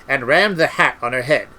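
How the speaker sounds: usual speaking voice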